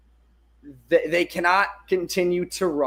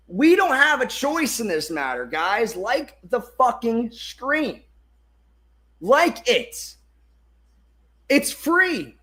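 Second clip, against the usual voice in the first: high-pitched insistent exhortation, falling at each end; yelling; 6 semitones higher than usual. Real, not acted. Streamer pushing viewers to like and believe, yelling. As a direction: yell at them to get on board, pitched up. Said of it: "I'm yelling. I'm yelling at you".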